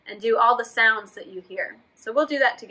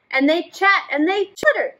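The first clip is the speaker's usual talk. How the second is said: usual speaking voice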